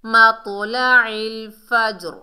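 loud slow मतलइल with mid-throat ain; careful recitation. Shown as a demonstration. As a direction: chant मतलइल फज्र, bouncing the j. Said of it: "आइन को हलक के दरमियान से".

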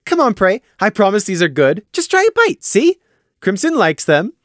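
very high, loud, eager voice; coaxing mayor. Acted. Taffy urges Prey to eat, very high and eager. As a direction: press him to try one.

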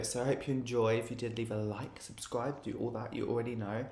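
winding down the video quietly; hushed outro. Real, not acted. A vlogger signing off to viewers, hushed and soft.

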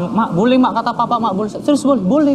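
high-pitched, excited rush of good news; eager retold plea. Son telling mother that papa said yes, excitedly. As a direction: act out a boy's eager announcement. Excited boy reports father's permission.

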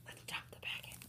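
hushed secret whisper. Pretend. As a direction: keep it barely audible, plotting. Conspiratorial whisper continues.

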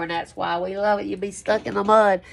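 ordinary talk

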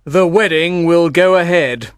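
firm, impatient father's voice; acted decisive irritation. A narrator's reading. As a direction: insist with mild impatience. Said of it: "he said impatiently".

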